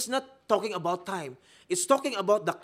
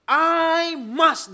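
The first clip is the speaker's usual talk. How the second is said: loud and high, stress on I; emphatic quote; 9 semitones higher, 10 dB louder than usual. Acted. Preacher quoting Jesus to the congregation, loud and emphatic. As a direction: punch the words I must.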